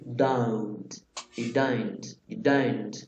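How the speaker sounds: "don't" in a British accent, its vowel an O U glide like "daun". Shown as a demonstration. Teacher drilling the British "don't" with students. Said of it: "Es como si fuera una O U".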